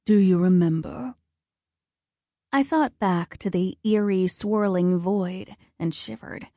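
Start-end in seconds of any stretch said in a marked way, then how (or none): 0.0-1.1 measured voice of a solemn god; stern god of war. A narrator's reading. Ares questions his daughter, solemn god voice. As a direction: play a grave, commanding father.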